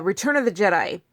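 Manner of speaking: ordinary talk, nothing marked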